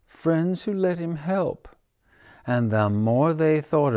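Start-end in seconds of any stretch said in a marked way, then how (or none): 0.1-1.7 higher, mildly aggrieved squirrel voice; a touch of wounded sulk; hurt squirrel. Acted. The squirrel sulks that friends let him help. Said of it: "yelped the squirrel".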